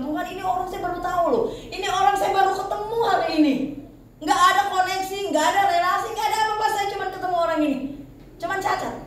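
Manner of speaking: high, fervent prophetic plea; quoted prophet praying fervently to God; fervent prayer. Retold or imitated. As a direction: pray out like a prophet pleading.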